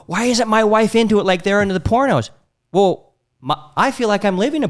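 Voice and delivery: high-pitched puzzled complaint; mock whining husband. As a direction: whine like a baffled husband, imitated.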